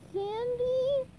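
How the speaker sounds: high, drawn-out calling; high searching call; SpongeBob. A narrator's reading. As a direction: call into an empty place, rising. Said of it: "SpongeBob repeated".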